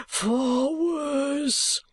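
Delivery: whispered, breathless dread; horrified whisper. Acted. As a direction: hiss it in horror. Gride in panic.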